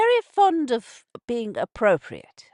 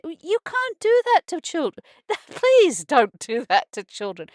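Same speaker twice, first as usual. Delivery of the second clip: pleading outburst; 7 semitones higher, 6 dB louder than usual. Real, not acted. Pleading about children being hurt.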